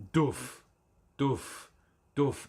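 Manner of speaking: a mouthed kick drum, three even beats; beatbox kick drum. Shown as a demonstration. Showing viewers how a looper works, beatboxed kick. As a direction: thump out a bass drum with your lips. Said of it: "So you go".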